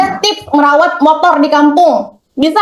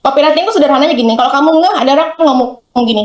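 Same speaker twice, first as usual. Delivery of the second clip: ordinary talk, nothing marked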